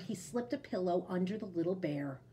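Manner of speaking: ordinary delivery, nothing marked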